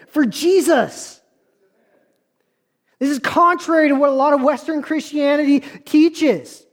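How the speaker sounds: emphatic preaching. Acted. Preacher asking how many of us live that way, emphatic preaching. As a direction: press each question on the congregation.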